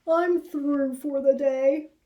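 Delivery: a worn-out rabbit done for the day; weary Harold. Acted. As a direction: flop down with a tired sigh. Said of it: "said Harold".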